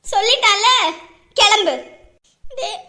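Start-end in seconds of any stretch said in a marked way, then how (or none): 0.0-1.7 loud harsh order; angry shout. Acted. Aunt ordering the daughter out, angry shout. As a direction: bark her out.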